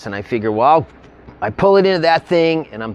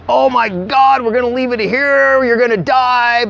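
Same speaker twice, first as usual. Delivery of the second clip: high, frantic mock panic; freaking out; 9 semitones higher than usual. Friend freaking out after the runaway RV, high frantic mock panic. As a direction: squeal it like a friend losing his mind. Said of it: "he's just freaking out".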